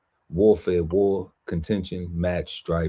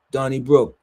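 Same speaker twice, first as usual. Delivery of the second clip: Donnybrook said higher and louder; emphatic repeat; 6 semitones higher, 5 dB louder than usual. Shown as a demonstration. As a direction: belt out Donnybrook with gusto. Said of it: "second take louder and higher".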